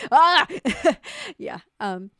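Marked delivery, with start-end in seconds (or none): 0.1-0.5 short, loud, high yelp; laughing shriek. Real, not acted. Streamer recalling own wandering off, loud laughing yelp. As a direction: yelp between bursts of laughter.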